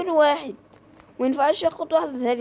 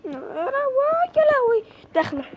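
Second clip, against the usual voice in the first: panicked shriek; 11 semitones higher, 3 dB louder than usual. Real, not acted. Gamer panicking as the enemy comes in, shrieking.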